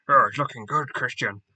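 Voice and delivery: loud, badly faked British commentator accent; hammed-up commentator's accent, loud then dropping off; Bisping accent. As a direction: put on a bad accent, calling the fight. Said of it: "That's a shit accent".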